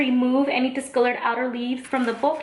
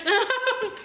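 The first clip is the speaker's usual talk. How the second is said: light laugh; 10 semitones higher than usual. Real, not acted. Laughs after tasting.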